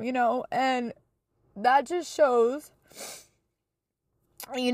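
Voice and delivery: tearful, high-pitched admiration; moved, holding it together; real emotional. Real, not acted. Daughter describing mom caring for sick grandma, emotional and high-pitched. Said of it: "Oh my God, I'm so sorry".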